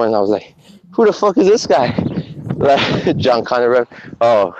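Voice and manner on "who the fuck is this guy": higher, incredulous "who the fuck"; indignant reaction. Retold or imitated. Reacting to the vegan video, higher incredulous scoff. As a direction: scoff at the screen in disbelief.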